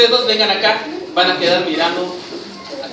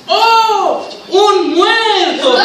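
high, drawn-out theatrical discovery; demonstrated shock; 10 semitones higher, 4 dB louder, much slower than usual. Shown as a demonstration. Director shows the policeman finding a body, exaggerated shock. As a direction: stretch it out high and loud, hammed-up astonishment. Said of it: "Cuando llegues vas a decir".

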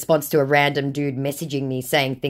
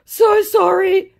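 mock scammer; 14 semitones higher, slower than usual. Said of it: "he said".

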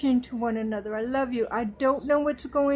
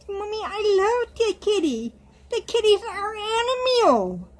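put-on high, louder little-girl voice; childlike doll voice; 8 semitones higher, 3 dB louder than usual. Doll speaking to Mommy, high, louder little-girl voice. Doll's voice again.

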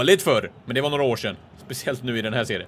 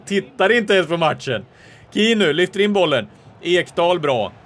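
loud, raised live commentary; animated play-by-play; 5 semitones higher, 7 dB louder than usual. Real, not acted. Commentator following his team's move forward, loud and worked up. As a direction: push the voice up and follow the ball.

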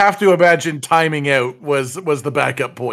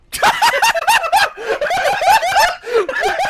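long, very high squealing laugh; helpless laughter. Real, not acted. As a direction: lose it in a high-pitched fit. Laughing at teammates' mistake.

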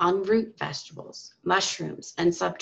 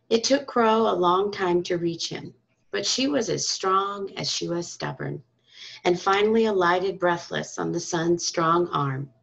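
usual speaking voice

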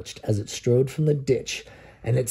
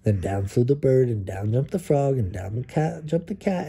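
plain reading voice

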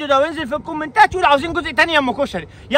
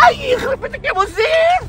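wailing cry; 10 semitones higher than usual. Acted. Mother wails as the character falls.

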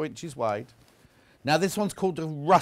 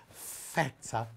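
softer, imitated Greekish accent; 2 semitones lower, 7 dB quieter than usual. Host mimicking Hannah's only Greek word, Greekish accent. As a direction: put a touch of mock Greek on it. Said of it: "she said it in a Greekish accent".

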